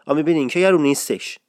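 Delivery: usual speaking voice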